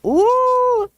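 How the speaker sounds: high, ghostly "ooh"; spooky ooh. Pretend. Host reacting to haunted hotel story, high spooky ooh. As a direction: wail it high like a mock ghost.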